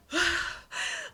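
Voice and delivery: long decompressing sigh; acted sigh of relief after an ordeal; big relieved exhale. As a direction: let all the breath go. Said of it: "I was just like".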